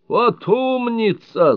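very high, slow squeal of praise. A narrator's reading. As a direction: squeal it out with delight. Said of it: "заверещал Мартин".

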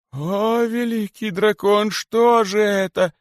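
very high, frightened wail; frightened hissing. A narrator's reading. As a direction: shriek in fear. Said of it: "шипели испуганные удавихи". (испуганные удавихи шипят высоко)

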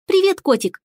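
put-on high, delighted little girl; a small girl greets a cat. A narrator's reading.

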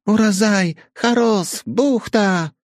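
high, loud halting broken Russian. A narrator's reading. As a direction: shout the words like a learner of broken Russian. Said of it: "начали они объясняться на ломаном русском".

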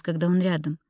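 usual speaking voice

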